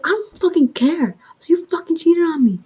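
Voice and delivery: high angry accusation; mock furious. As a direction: throw it at him. Making a scene at ex.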